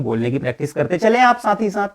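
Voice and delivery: ordinary talk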